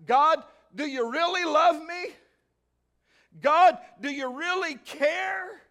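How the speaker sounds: high, pleading voice of doubt; doubting plea. Acted. A believer questioning God, a high, pleading whine. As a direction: ask it like a hurt child. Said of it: "do we ask".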